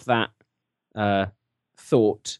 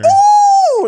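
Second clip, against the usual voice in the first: loud high falsetto whoop; really loud falsetto ooh; 12 dB louder than usual. Shown as a demonstration. Madam Doubtfire greeting Scott with a loud falsetto ooh. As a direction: belt out a shrill falsetto ooh. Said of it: "Really loud".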